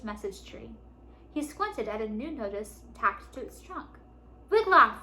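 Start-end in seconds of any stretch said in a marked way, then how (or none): 4.5-5.0 high, loud call of a name; shouting. A narrator's reading. Father shouting for his son at the message tree, loud shout. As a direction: shout for him to come. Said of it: "Fergus shouted".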